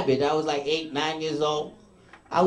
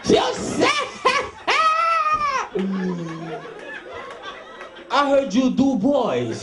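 high, laughing, taunting Brooklyn tough; Brooklyn inmate; 7 semitones higher than usual. Retold or imitated. Big Brooklyn inmate taunting the new guy in jail, laughing jeer. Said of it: "some big dude in jail from Brooklyn be like".

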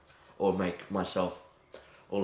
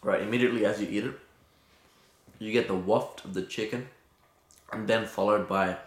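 chewing while talking; muffled around food; mouth full of pizza. Real, not acted. Seen 0: Bit pizza at -7; lips working, pizza held throughout.

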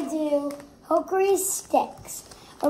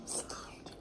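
giggly whisper; 21 dB quieter than usual. Real, not acted. Kid nervous before tasting.

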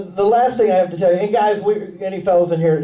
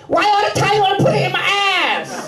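much higher squeaky complaining voice; higher hammed-up girl's complaint; mock girlfriend complaint; 13 semitones higher than usual. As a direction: whine it high like a fed-up girlfriend. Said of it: "she says".

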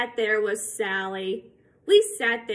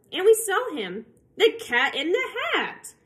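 higher, louder, grand unveiling of the cat; excited reveal; 8 semitones higher, 4 dB louder than usual. Acted. Reading to kids, an excited high reveal of the cat. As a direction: announce him like a surprise guest.

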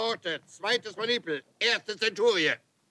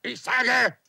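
normal talking voice

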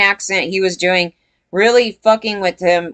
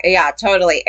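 normal talking voice

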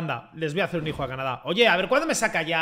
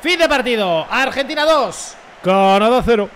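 loud, raised, drawn-out commentator call; final-whistle announcement; 4 semitones higher, 8 dB louder than usual. Real, not acted. Streamer to viewers at full time, loud announcer call. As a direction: proclaim the result like a match announcer.

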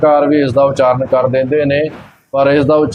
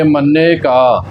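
slow phrase resting on ਕਾ; pause after ਕਾ; much slower than usual. Shown as a demonstration. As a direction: draw out ਕਾ and stop there. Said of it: "ਕਾ ਤੇ ਵਿਸ਼ਰਾਮ ਲੱਗਣਾ ਹੈ".

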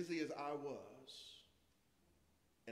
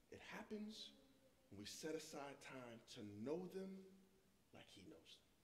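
hushed; 9 dB quieter than usual. Acted. Soft emphasis in sermon.

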